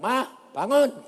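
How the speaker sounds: high, loud call to mother; wake-up command. Retold or imitated. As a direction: order her awake, stretching the call. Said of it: "Saya bilang".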